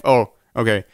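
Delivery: usual speaking voice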